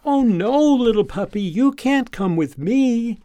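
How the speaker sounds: high, loud, singsong primer-book tone; a girl pleading sweetly with a puppy that won't stop following; storybook child voice. Acted. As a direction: talk to the puppy like Dick and Jane to Spot. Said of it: "like Dick and Jane used to talk to Spot".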